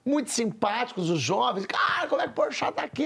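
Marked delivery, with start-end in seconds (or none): 1.6-3.0 high, fast, astonished; amazed fan. Retold or imitated. Fan asking how the host got there, high and amazed. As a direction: play a thrilled young fan. Fans amazed to see him.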